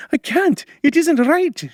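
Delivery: very high, loud wail of refusal; distressed cry. A narrator's reading. Frightened girl refusing to burn him, crying out. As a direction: cry out, pleading against the order. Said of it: "she cried".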